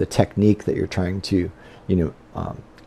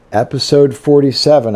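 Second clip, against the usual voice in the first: ordinary delivery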